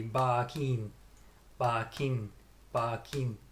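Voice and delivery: Japanese-accented "barking", both syllables equally stressed; Japanese-style even stress on "barking". Shown as a demonstration. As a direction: imitate a Japanese accent with a full "keen" in "barking". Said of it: "you'd say both the same".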